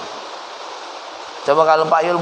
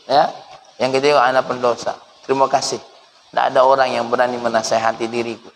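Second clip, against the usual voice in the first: tearful admission; 6 semitones lower than usual. Acted. Habib answering his accuser, mildly tearful and humble. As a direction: confess it gently, moved. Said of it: "Langsung nangis beliau".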